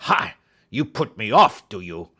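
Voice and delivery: loud, sharp bark, then a snarl; angry outburst. A narrator's reading. Roylott exploding at being put off, loud bark. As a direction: blast it at him.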